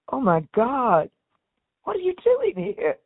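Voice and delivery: David can't believe she came; amazed greeting that leaps up high. Retold or imitated. David amazed to see her.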